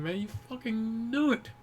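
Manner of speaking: ordinary talk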